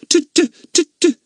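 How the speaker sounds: high, loud pretend stammer. A narrator's reading. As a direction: stammer as if scared. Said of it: "stammered Fatty".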